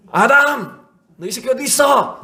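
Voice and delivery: loud, high, hammed-up scolding; mock angry yell. Preacher imagining God cursing at hiding Adam, mock furious. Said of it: "이렇게 하셨나요?".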